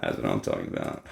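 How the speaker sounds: lightly put-on deep bro voice; deep mock voice. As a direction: drop into a deep goofy voice. Deep comic voice.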